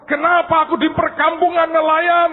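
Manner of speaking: high, loud, bewildered shout; outraged cry. Acted. Tree protesting at the fishing village, shouting. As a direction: cry out why this happened. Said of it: "dia teriak".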